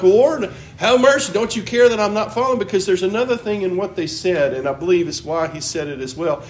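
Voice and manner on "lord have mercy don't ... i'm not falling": louder, higher frantic cry for mercy; panicked plea. Acted. Frightened disciples cry to the Lord, loud and high. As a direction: plead loudly as the boat fills.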